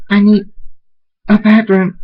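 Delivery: high, loud desperate cry; squeaky, frantic loud plea; urgent plea. Acted. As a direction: blurt it out in a panic. Desperate after disgusting discovery.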